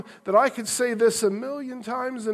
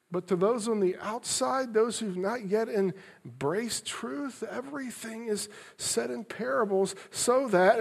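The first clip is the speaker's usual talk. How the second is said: sad and tender; 5 dB quieter than usual. Shown as a demonstration. Preacher showing the congregation Jesus' compassion, soft and sad. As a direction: say it quietly with a grieving heart. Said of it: "Hear them with an inflection of sadness, of compassion, of love".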